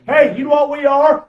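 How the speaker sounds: rousing call. Real, not acted. Preacher rousing the congregation.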